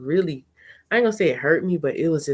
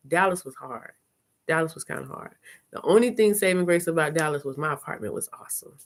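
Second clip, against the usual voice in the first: ordinary talk, nothing marked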